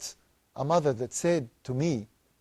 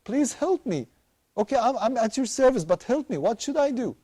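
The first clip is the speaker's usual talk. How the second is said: put-on higher pleading child, rising toward desperate; pleading little girl; 5 semitones higher than usual. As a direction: beg Dad for help like a crying six-year-old. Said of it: "And she said".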